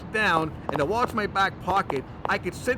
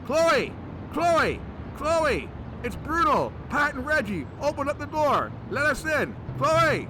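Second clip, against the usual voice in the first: higher, calling through a door; 7 semitones higher, slower than usual. Acted. Bruno calling Chloe through the locked store door, yelling. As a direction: yell for her to open up.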